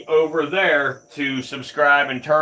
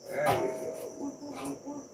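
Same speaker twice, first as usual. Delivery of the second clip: much higher, slow sing-song coo; drawn-out, high, baby-talk croon; 12 semitones higher, 14 dB quieter, much slower than usual. Acted. Cooing to a snake.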